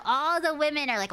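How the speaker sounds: normal talking voice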